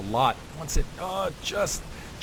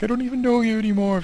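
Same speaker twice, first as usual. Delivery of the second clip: heartbroken mock wail; 11 semitones higher, 5 dB louder than usual. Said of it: "I would be like".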